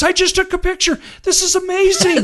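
high, loud thrilled cry with laughter; excited scream. Retold or imitated. Stranger photographer thrilled by the light, high laughing cry. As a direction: squeal with delight at the shot. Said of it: "scream to the light".

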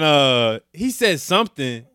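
ordinary talk, nothing marked

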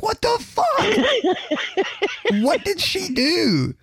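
mock shock, cracking up; laughing disbelief. Laughing as he retells hearing the dog flop down. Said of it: "And I'm like".

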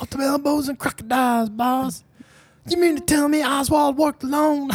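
very high, hammed-up Southern movie voice; movie Southern accent. Podcaster repeating the JFK film's lines, mock Southern accent. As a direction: overact a bad Hollywood Southern accent. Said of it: "like, just like that".

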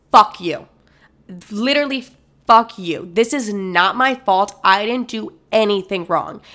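forceful, emphatic retort; firm and indignant, landing hard on the key words; defiant. Retold or imitated. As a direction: refuse the blame, punching each phrase. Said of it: "instead of being scared and being, like, terrified".

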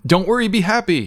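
mild mock yell. Host pretending to yell from the tower top, mock yell. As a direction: play at calling out from up high. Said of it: "just start yelling out stuff like".

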